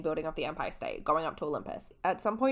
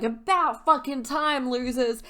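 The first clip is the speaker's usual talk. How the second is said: louder, higher, slow jeering yell; mock shout; 6 semitones higher, 5 dB louder, slower than usual. Campers throwing the couple into the lake, jeering. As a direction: holler it at them like rowdy campers.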